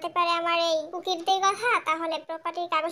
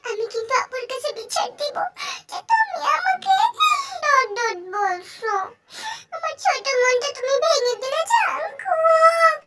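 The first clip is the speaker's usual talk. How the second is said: whiny crying voice; 8 semitones higher, 6 dB louder, slower than usual. Acted. Jimin threatens to tell sister, sulking.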